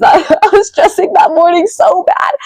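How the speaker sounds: high-pitched, laughing through the words; laughing recollection. Real, not acted. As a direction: laugh helplessly at your own past stress. Laughs recalling her own stress.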